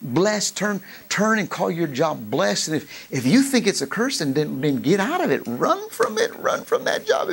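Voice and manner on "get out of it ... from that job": very high, breathy urging; preacher urging the church to flee, high and breathless; urgent cry. Real, not acted. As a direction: cry it out like a warning. Urging congregation to flee curse.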